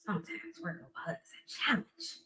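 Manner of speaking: gasp, then a secret whisper; whispered aside. Pretend. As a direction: let the audience in on a secret. Aside about her alter ego.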